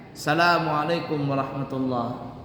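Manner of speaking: Assalamualaikum said as salamualaikum; dropped syllable. Shown as a demonstration. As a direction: clip off the opening as. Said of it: "Tidak sah seperti itu".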